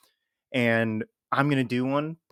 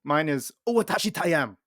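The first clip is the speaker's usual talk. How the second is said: put-on Japanese accent; 5 semitones higher than usual. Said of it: "doing a Japanese accent".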